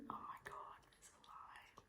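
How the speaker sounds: breathless stunned whisper. Real, not acted. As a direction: mouth it under your breath. Whispers at test.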